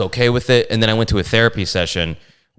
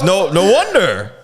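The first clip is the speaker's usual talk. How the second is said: 1 mocking heckler; 9 semitones higher, slower than usual. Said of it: "And he goes".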